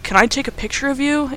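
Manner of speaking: high-pitched, lightly coy question; a touch of cute, hopeful flirting; flirty request. Retold or imitated. Adam asks Amanda for a photo, lightly acted flirting. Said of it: "a whole little flirting moment".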